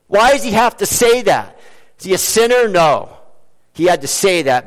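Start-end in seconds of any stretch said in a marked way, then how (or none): none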